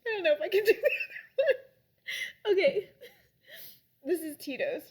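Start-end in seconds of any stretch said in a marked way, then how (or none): 0.1-2.9 real teary laughter, much higher and louder; half sob, half laugh; laughing crying. Real, not acted. Dreading the next vodka shot, laughing through tears. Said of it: "crying over vodka".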